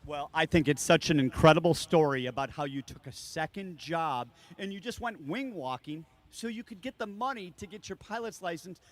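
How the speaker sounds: normal talking voice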